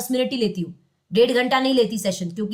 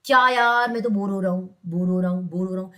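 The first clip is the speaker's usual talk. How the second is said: bored inner voice; 6 semitones lower than usual. Retold or imitated. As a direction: sigh it out like a bored mind. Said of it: "वही thought देता है". (दिमाग की ऊबी हुई धीमी आवाज़)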